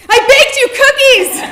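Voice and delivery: shouted high and loud over a band; excited shout over loud music. Retold or imitated. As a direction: yell it across a noisy venue. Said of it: "I shouted over the same dreadful opening band".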